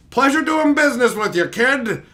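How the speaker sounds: high, loud put-on send-off; imitated cocky goodbye; plumber's parting line. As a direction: play an over-hearty tradesman signing off.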